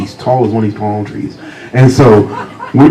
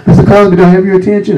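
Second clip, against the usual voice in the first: teacher calling out; 10 semitones higher, 5 dB louder, faster than usual. Retold or imitated. Teacher calls him at the door.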